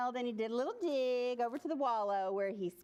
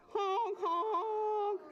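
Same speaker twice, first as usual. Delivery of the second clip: put-on long high honks for children to copy; goose honk; 8 semitones higher than usual. Shown as a demonstration. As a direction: demonstrate a drawn-out goose honk. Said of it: "Can you honk".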